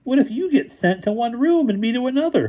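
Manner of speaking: high, strangled and tight. A narrator's reading. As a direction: choke the words out. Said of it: "in a strangled voice".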